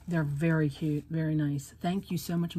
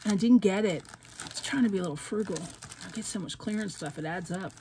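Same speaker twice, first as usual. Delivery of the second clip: ordinary delivery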